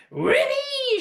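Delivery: put-on very high, loud cackling old woman's whoop; gleeful shout. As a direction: whoop for joy like a wild old crone. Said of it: "she shouted".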